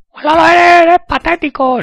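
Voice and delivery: mock him in a chipmunk squeak; squeaky taunting voice. (voz aguda burlona que lo insulta)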